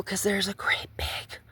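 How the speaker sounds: quick cautious whisper. Real, not acted. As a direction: whisper it carefully. Warns herself about a nail.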